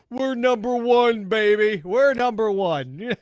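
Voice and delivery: hammed-up boast of a winning crowd; mock cheering. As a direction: states cheering over their case counts. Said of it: "America going".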